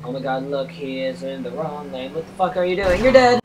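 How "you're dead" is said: loud, high yell at a driver; hammed-up angry yell; mock threat. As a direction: shout a playful threat.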